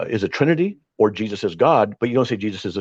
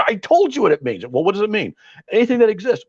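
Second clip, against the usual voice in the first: mocking the opponent; 10 semitones higher than usual. Mock opponent in retold argument.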